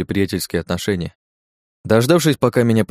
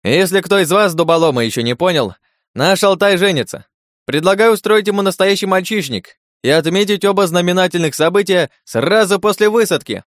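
loud, high showman announcing; booming circus ringmaster; 10 semitones higher, 4 dB louder than usual. Acted. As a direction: work the crowd like an MC. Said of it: "продолжил после паузы".

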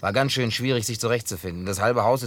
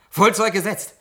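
delighted exclamation; 10 semitones higher, 7 dB louder than usual. Acted. Delighted by model ship.

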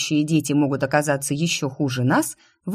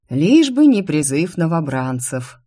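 plain reading voice

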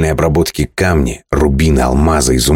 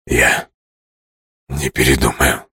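low, hoarse, softer; hoarse promise; 3 semitones lower, 4 dB quieter than usual. Acted. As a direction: rasp it out breathlessly. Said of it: "хрипло пообещал он". (хриплое обещание)

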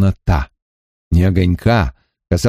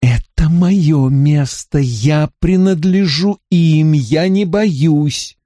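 fierce; 5 semitones higher, slower than usual. A narrator's reading. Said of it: "Она яростно сказала себе".

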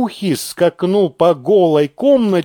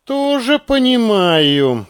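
slow, higher-pitched concession; agreeable; 7 semitones higher, slower than usual. A narrator's reading. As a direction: give in easily, drawing it out. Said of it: "покладисто сказал подполковник". (покладисто, нараспев и повыше)

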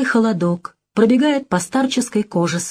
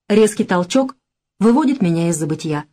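plain reading voice

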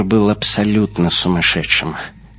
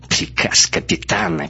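mocking mimicry; 2 semitones lower than usual. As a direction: mockingly parrot the captain's order. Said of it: "передразнила Моник".

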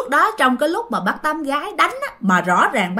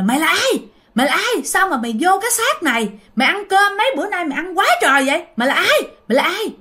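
higher, sharp interrogating yell; possessed-sounding higher yell at the ghost; strange voice, shouting; 7 semitones higher, faster than usual. Retold or imitated. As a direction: demand who the spirit is, higher. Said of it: "cái giọng của bác Tám gái cũng không giống".

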